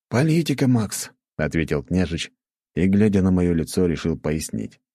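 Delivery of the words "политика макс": lightly voiced schoolboy friend, higher pitch; friend's voice. A narrator's reading. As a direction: lift the pitch a little for the friend. Narrator voices friend answering. (слегка повышенный голос друга)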